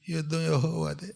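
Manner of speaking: soft limp chant of యుద్ధం యెహోవాదే; weak, listless. Shown as a demonstration. Preacher mimics the congregation's listless response, softly. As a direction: mumble it with no energy. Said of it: "ఎంత నీరసంగా ఉన్నారు".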